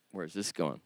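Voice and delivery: very low, muted aside; quiet, doubtful thought aloud; wary aside. Retold or imitated. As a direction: drop it under your voice, suspicious. Wary inner reaction in anecdote.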